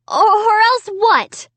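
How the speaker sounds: high, loud, stammering; frightened cry. A narrator's reading. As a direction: cry out in fear. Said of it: "I cried".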